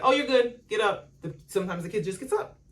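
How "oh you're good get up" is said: higher, louder breezy brush-off; imitating calm parent. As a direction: shrug off the fall. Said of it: "If the parent's like".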